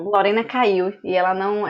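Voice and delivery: ordinary talk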